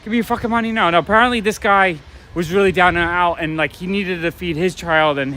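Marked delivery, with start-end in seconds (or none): 0.1-0.9 retold scream. Robber threatening a woman for her money, mock scream. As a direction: imitate a robber demanding cash, half-screamed. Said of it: "the guy starts screaming".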